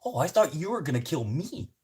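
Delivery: high, loud laughing confession; driver's outburst. Retold or imitated. Driver admitting he feared the passenger, high and loud. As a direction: blurt it back, just as scared. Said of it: "And the guy's like".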